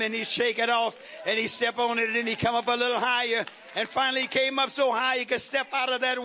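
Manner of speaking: full-force preaching. Real, not acted. Preacher rallying the congregation to shake it off, building fervour. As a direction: build steadily and urge the congregation on.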